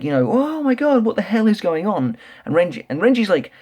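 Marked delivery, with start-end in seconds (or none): 0.3-2.2 panicked. Retold or imitated. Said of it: "he's kind of like".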